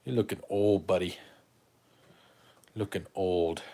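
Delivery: doting dog talk; teasing the dog. Real, not acted. Teasing his old dog.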